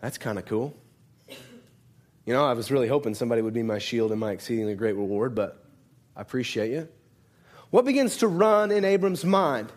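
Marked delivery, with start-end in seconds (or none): 0.0-6.9 low, fast, offhand shrug of a reply; lower, quicker, dismissive mock reply; mock unimpressed Abraham. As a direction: play Abraham as comically underwhelmed by God. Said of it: "Does he go".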